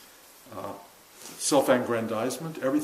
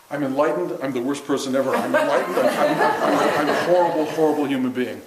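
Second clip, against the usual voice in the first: swinging between elation and despair; 2 semitones higher, 5 dB louder than usual. Retold or imitated. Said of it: "made me go on this roller coaster ride".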